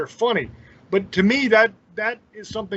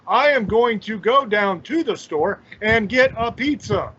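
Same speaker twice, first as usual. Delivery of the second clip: slow, over-enunciated word-by-word reading; stiff, laboured imitation of bad acting; stilted bad acting; 3 semitones higher, slower than usual. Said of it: "that's how they talk".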